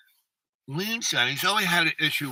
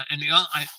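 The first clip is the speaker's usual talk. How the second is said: normal talking voice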